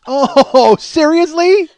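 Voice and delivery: high, loud comic incredulity; exasperated disbelief. Acted. Husband tricked by his wife at the sandwich shop, incredulous. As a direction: protest it in pained, laughing disbelief. Mock outrage at being tricked.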